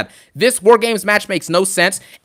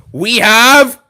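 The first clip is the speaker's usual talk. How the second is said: loud booming announcement; dramatic announcer voice; Regal announcement yell; 2 semitones higher, 6 dB louder, much slower than usual. Retold or imitated. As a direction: proclaim it like a grand ringside announcer. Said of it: "lower your volumes for this".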